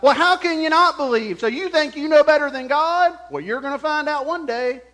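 high, loud mock reproach; judgmental retort. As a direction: imitate a believer berating a doubter. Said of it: "That's not what Jesus does here".

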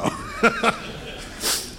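breathy burst of laughter; real laugh after a quip; amused chuckle. Real, not acted. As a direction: laugh at your own joke. Laughing at own joke.

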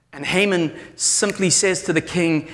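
ordinary delivery

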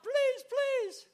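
high wailing plea; hammed-up high whimper, fading at the end; crying out hungry. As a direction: wail for food like a pitiful beggar. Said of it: "he's crying out".

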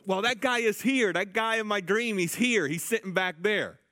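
she's sure and won't let it go; excited insisting. Retold or imitated. Sister insisting the guy is here.

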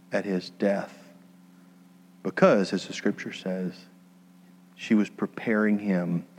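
hushed, earnest sermon voice; soft preaching. Acted. Soft reflective preaching close.

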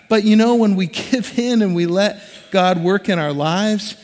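ordinary delivery

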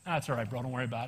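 soft, low, easygoing; the driver now calm and quiet; calmed-down reply. Retold or imitated. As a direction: let it go gently.

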